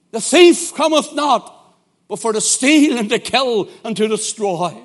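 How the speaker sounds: fervent preaching. Acted. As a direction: preach it hard to the congregation. Preaching at full force.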